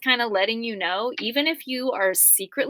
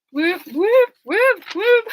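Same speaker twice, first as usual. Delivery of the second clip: high, loud siren-style whoops; mock siren whoop; 10 semitones higher, 8 dB louder, much slower than usual. Cheering herself on as a world-saver, siren whoops. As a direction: whoop it like an alarm going off.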